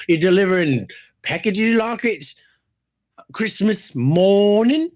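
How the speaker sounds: drawn-out, rising mock question; sarcastic jab. Podcaster needles his interrupting co-host, sarcastic. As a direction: stretch it slowly into a climbing, mocking question.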